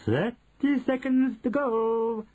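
high, long-drawn countdown call; very slow, high-pitched witch announcement; Grand High Witch crying out. A narrator's reading. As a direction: stretch the words out in a witchy cry. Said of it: "cried the Grand High Witch".

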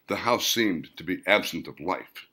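usual speaking voice

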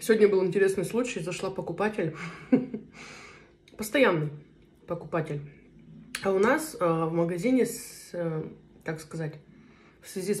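speaking between bites; chewing while talking. Real, not acted. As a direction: mouth partly full. Seen 0: Spoon holding salad; lips pressed, jaw working between phrases like chewing.

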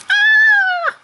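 very high, loud mock scream; hammed-up scream of a bear missing his deadline; panicked bear scream. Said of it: "He's like".

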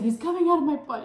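high, loud panicked yell. Retold or imitated. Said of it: "I was like".

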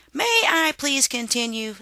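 high, loud exasperated outburst; exasperated scream. A narrator's reading. Father worm snapping at wife and son, exasperated shout. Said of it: "screamed Father Worm".

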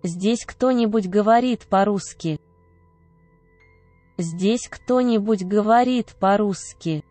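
normal talking voice

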